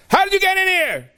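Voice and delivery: high, loud frightened cry; startled shout. Acted. King startled by intruder.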